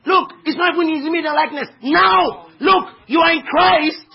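high, loud preacher's shout; exasperated rebuke. Acted. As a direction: cry it out as heaven scolding a confused believer. Voicing heaven's exasperated rebuke, rising to shout.

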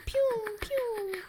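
high mouth-made pew pew; gunshot sounds. Pretend. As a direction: toy gun noises. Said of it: "I can't make a gun noise other than pew".

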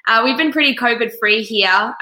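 stuffy nose from a cold; blocked nose, talking nasally. Real, not acted. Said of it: "sorry for my nasally voice".

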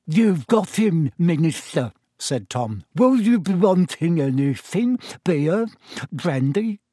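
put-on a toothless old man, fawning over the Minister; old landlord Tom. A narrator's reading. Landlord offers the Minister a drink, slow and wizened.

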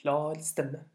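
usual speaking voice

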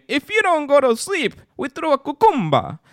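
high, playful mock Jamaican chant; pretend Jamaican. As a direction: threaten bedtime mischief in a Jamaican accent. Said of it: "And then we start saying".

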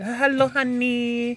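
high, very slow mock Irish; bad Irish accent. Repeating his demo for doubting co-host, bad Irish accent. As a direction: stretch it into a hopeless Irish lilt. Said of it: "Like that. Like, I just did it".

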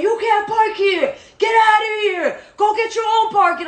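high, drawn-out mock holler; retold yell. Retelling the man yelling through the window, mock yell. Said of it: "he was yelling through the window".

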